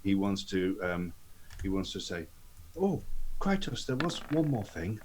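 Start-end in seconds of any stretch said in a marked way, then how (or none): 2.8-5.0 lightly acted parting question, lifted high on the "Oh"; higher-pitched, offhand catch-him-out line; casual afterthought. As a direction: toss it in as if it just occurred to you. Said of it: "Just to try to catch him by surprise".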